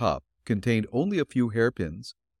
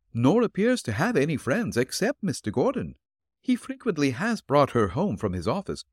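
put-on higher, gentle old woman's voice; elderly landlady voice; 5 semitones higher than usual. Landlady answering the detective at her door, politely.

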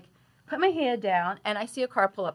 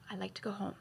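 fed-up mutter; 13 dB quieter, faster than usual. Retold or imitated. Irritated, waiting for her dog.

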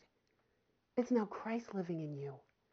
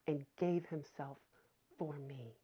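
fading hush; 6 semitones lower, 6 dB quieter than usual. Real, not acted. Preacher fading softly on the cross, hushed. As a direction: let it fall away to almost nothing. Preacher fades on key phrase.

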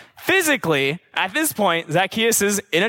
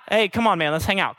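easygoing, quick throwaway invite; casual invitation; 3 semitones lower than usual. Shown as a demonstration. The wrong reading of Jesus' call, casual invitation. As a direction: toss it off like asking a buddy along.

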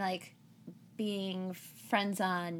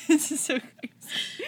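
laughing; 6 semitones higher, 5 dB louder than usual. Real, not acted. Giddy laugh about podcast launch.

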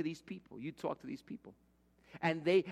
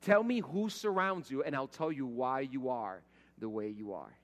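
ordinary delivery

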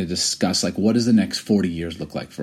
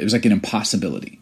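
ordinary delivery

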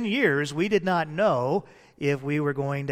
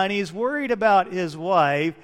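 ordinary delivery

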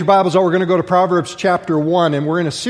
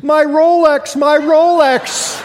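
much higher and louder, very slow hammed-up wail; punchline wail, high, loud and stretched out; mock wail; 9 semitones higher, 6 dB louder, much slower than usual. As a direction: cry out for the watch in exaggerated horror. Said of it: "looked down in horror and he goes".

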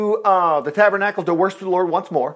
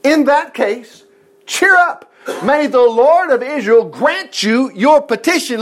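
slow, higher, grand blessing; solemn priestly blessing; 6 semitones higher, 4 dB louder, slower than usual. Retold or imitated. Eli blessing Hannah at the tabernacle, slow and solemn. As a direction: bestow it slowly and grandly. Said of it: "He never stumbled".

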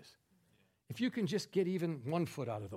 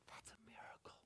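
whispered in hushed awe; awed whisper; 21 dB quieter than usual. Acted. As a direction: barely breathe it in wonder. Lady awed by revived cat.

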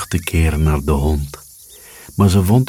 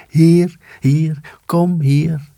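much higher tiny insect voice; high firefly call; 11 semitones higher, slower than usual. A narrator's reading. Fireflies beckoning each other, high and small. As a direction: call out like a glowing bug. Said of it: "dat riepen ze nog".

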